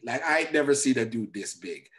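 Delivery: ordinary talk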